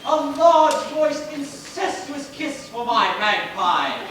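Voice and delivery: slow, flamboyant flourish; grand theatrical gush. Acted. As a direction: savour every word. Theatrical greeting.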